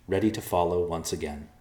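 ordinary delivery, nothing marked

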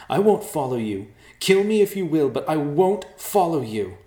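high, loud defiant refusal; defiant shout. Acted.

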